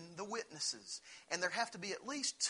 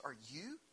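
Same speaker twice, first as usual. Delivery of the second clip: soft, quiet question leaning on "you"; stress on you; 7 dB quieter than usual. Shown as a demonstration. Preacher showing Pilate's stress: "you" leaned on softly. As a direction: press the word "you" gently as a quiet challenge. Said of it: "he em-, makes that emphasis".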